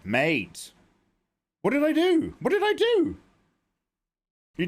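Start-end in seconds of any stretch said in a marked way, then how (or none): 1.6-2.3 high startled what did I do; shocked outburst. Real, not acted. Streamer reacting to chat in shock, high disbelieving cry. 2.4-3.2 even higher, squeaked protest; squeaky outrage. Real, not acted. Streamer protesting to chat, squeaky mock outrage.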